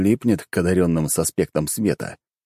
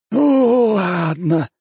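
high, slow grumble; grumbling house spirit; 13 semitones higher, 5 dB louder, much slower than usual. Acted. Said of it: "бурчит домовой".

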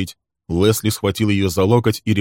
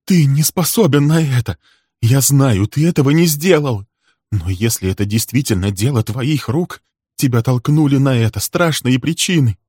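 higher, louder, impassioned woman's voice; insistent, emotional protest; distressed heroine; 7 semitones higher, 5 dB louder than usual. Acted. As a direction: plead his innocence with rising urgency. Heroine pleading with accused man.

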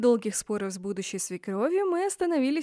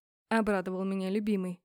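ordinary delivery, nothing marked

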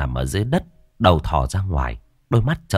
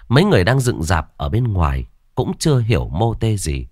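usual speaking voice

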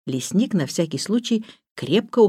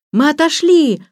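put-on children shouting; 10 semitones higher, 6 dB louder than usual. A narrator's reading. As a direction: call it out through the door like kids. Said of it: "прокричали ребята". (громкий детский крик)

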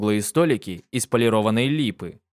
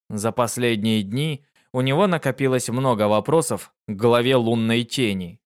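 plain reading voice

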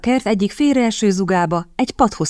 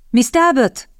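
higher-pitched call, falling at the end; calling her name; 7 semitones higher, 3 dB louder, slower than usual. A narrator's reading. Captain calls Miss Talbot across the windy garden, higher call. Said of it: "halkan szólongatja őt".

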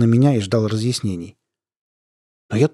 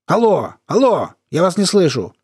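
raised, higher alloes; calling into phone; 7 semitones higher than usual. Pretend. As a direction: call out as if the line drops. Fakes losing the connection.

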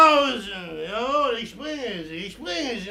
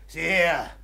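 ordinary talk

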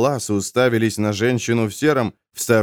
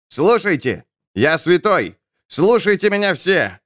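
raised, higher proclamation; calling out; 8 semitones higher than usual. A narrator's reading. Self-declared saint addresses the muttering crowd, calling out. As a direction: call the crowd to attention.

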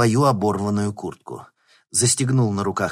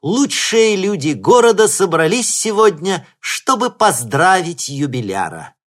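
loud, high, ceremonial announcer voice; solemn speech; 9 semitones higher, 10 dB louder than usual. Acted. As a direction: proclaim it grandly to the crowd. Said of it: "торжественно говорила Лидия".

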